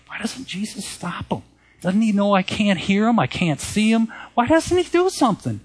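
high, exasperated pleading, rising to a squeak; frustrated. Retold or imitated. Preacher voicing Hagin, frustrated at the blocking spirit, high exasperated plea. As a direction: voice Hagin's impatient complaint, pitched high. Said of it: "Brother Hagin was getting frustrated".

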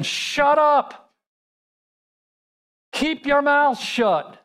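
high, emphatic retort to a panicker; imitated soldiers' urgent command; acted sharp order. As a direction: silence the wailer, each word stressed. Soldiers silencing a panicking man.

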